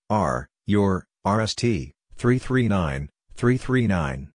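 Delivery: usual speaking voice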